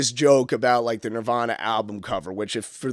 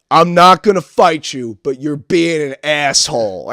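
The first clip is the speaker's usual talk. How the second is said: heckler's parting line; 3 semitones higher, 8 dB louder, slower than usual. Retold or imitated. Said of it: "he just goes".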